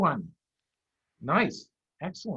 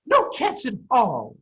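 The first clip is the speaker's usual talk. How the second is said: higher, louder sharp mother fox; Missus Fox snapping; 9 semitones higher, 8 dB louder, slower than usual. Acted. Mrs Fox snaps at her children. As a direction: cut them off firmly. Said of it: "snapped Missus Fox".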